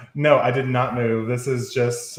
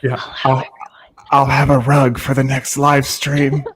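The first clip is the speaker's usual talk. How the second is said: whisper yelling; 7 dB louder than usual. Real, not acted. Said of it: "I really love to whisper into the microphone".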